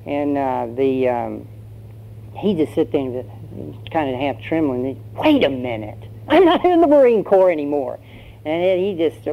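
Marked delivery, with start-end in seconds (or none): 5.2-8.0 raised-voice protest, as it dawns on him; sudden realization. Acted. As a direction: burst out in mock indignation. Recruit suddenly realizes he is free.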